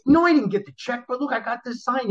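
high-pitched defensive reply; acted-out objection to the boss; retold protest. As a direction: plead your case in a high voice.

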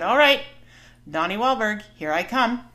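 giving in boldly. Retold or imitated. Ending an internal fight before meeting her idol, loud and resolved. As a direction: throw it out big, with sudden resolve. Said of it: "finally just threw up my hands and said".

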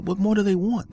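exasperated. Real, not acted. Exasperated repeat of the question.

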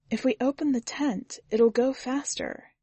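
Susie's voice. A narrator's reading. Susie speaks in story.

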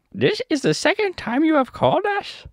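very high squeaky monkey voice; Monkey King, stern. Acted. Monkey King answers Dorothy's summons, high squeaky royal voice. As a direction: squeal it out as a cartoon monkey ruler.

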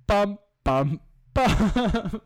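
high, loud dun-dun-dun; sung dramatic sting. Pretend. After reading the death, a mock high dramatic sting. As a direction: hum a mock suspense chord.

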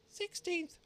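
very high, squeaky little voice; dormouse voice. A narrator's reading. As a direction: squeak it like a tiny mouse. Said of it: "said the Dormouse".

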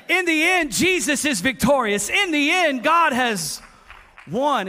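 loud, high, declaring victory; triumphant preaching. Acted. Preacher voicing the psalmist's victory to the church, loud and triumphant. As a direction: proclaim the end like a war cry. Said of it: "He said".

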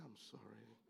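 very soft, low demonstrated apology; simple apology. Shown as a demonstration. Preacher showing men how to apologize, softly. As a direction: say it quietly, without force. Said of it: "just say it".

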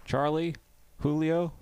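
high-pitched mock roll call; calling out names. Receptionist to waiting room, calling out names. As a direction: imitate someone calling the next patient, high.

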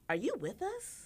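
high, bright mock-friendly question; a sorority girl sizing her up, high and sweet. Sorority girl quizzing the newcomer, high mock voice.

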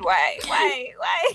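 escalating embarrassed whys; mock anguish. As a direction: wail it in cringing regret, hammed up. Acts out awkward regret.

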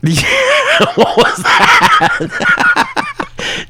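very high, drawn-out screeching laugh; long, high-pitched laughing shriek; demonic laugh. Real, not acted. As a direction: cackle like a demon from another realm. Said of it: "is that a demon from the other realm".

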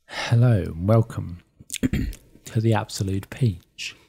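a quiet, wet-mouthed intro read; moist intro voice. Shown as a demonstration. Showing his co-host the wet sound, softly. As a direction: let the saliva be heard as you greet them. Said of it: "Listen, listen".

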